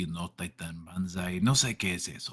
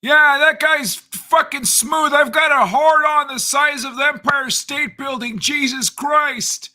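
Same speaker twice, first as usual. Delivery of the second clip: high shouting character voice; 10 dB louder than usual. Acted. New character reacts, shouting.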